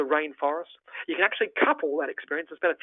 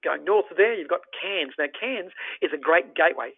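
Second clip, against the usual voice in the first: normal talking voice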